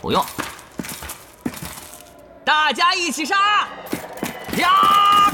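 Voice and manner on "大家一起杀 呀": battle cry. Acted. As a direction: throw yourself into the fight. Battle cry charging monsters. (冲锋时高声呐喊)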